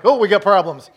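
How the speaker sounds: loud comic alarm at his own slip; mock alarm. Pastor mocking his own mix-up, loud alarm. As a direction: laugh at yourself in mock panic. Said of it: "I'm like".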